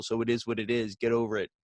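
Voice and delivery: usual speaking voice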